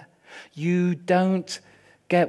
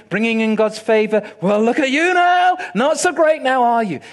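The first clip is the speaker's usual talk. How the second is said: mocking jeer; 3 semitones higher, 5 dB louder than usual. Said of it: "is mocking".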